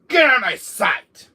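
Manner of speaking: loud, high furious order; angry dismissal. Acted. Villain ordering Chris away, furious. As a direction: drive them away with your voice.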